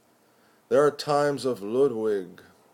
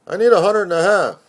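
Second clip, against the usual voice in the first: higher and much louder, fast and pushy; insistent demand; 9 semitones higher, 10 dB louder, faster than usual. Acted. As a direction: hammer the demand home, urgent. Friend repeats demand for money.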